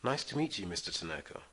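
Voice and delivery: normal talking voice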